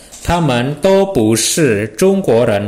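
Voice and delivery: slow, careful Mandarin model phrase; teacher reads it out slowly for students; Mandarin demo. Shown as a demonstration. Teacher models Mandarin phrase slowly and clearly. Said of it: "berarti semuanya tidak".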